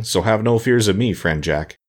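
ordinary delivery, nothing marked

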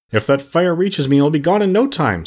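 understated fright at looming danger; trembling with fear. A narrator's reading. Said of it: "said he, trembling until his straw rattled".

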